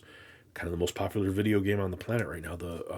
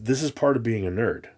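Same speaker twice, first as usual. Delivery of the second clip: ordinary talk, nothing marked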